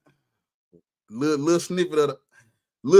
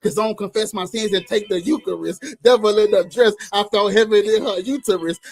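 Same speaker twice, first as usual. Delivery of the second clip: normal talking voice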